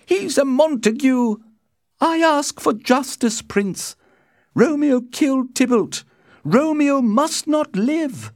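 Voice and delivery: very high, loud accusing woman; impassioned cry. A narrator's reading. Lady Capulet demands Romeo's death, crying out fiercely. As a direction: demand justice in a high outcry. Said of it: "cried Lady Capulet".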